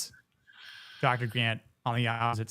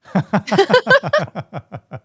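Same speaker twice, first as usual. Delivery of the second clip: sudden high laugh; loud burst of laughter; 9 semitones higher, 12 dB louder than usual. Real, not acted. Loud laugh after misreading.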